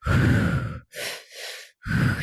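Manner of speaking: loud demonstrated breath in through the mouth; audible breathwork inhale; demo breath. Shown as a demonstration. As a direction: show the breath pattern aloud. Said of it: "which was".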